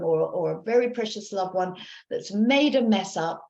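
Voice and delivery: ordinary talk, nothing marked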